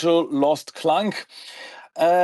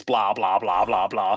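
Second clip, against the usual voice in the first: lower, droning blah blah; droning mock lecture; 8 semitones lower than usual. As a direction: drone it like a boring lecture. Mocking a lecture.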